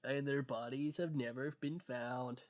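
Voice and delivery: slow, soft, stuffed-up video narrator; congested narrator voice. Acted. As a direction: narrate it quietly and gravely, congested. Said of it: "so I can do that voice quite well".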